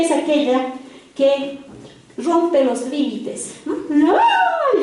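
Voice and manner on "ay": put-on high wailing "ay" like a bawling toddler; mock child wail. Preacher imitating a defiant toddler's tantrum, high mock wail. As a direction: squeal out a toddler's cry.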